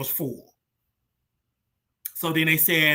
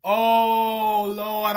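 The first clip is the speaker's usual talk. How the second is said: long, drawn-out mock wail; retold crying; 4 semitones higher than usual. Praying outside on a sleepless night, retold crying. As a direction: draw out a tearful cry to God. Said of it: "I was crying".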